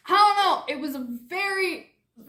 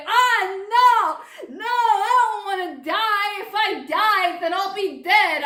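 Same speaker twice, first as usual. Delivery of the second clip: higher, louder, sobbing plea; mock crying; 5 semitones higher, 4 dB louder than usual. Patient wails to the doctor about dying, sobbing and pleading. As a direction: wail it like a panicked crybaby.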